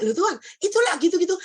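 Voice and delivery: high, loud, quick protest; acting out a startled reaction; surprised questioning. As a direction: blurt it out to God, taken aback. Acted startled question to God.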